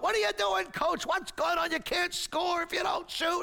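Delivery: high, exasperated heckling shout; yelling at the coach. Acted. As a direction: yell angry complaints from the bleachers. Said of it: "they're yelling at Coach".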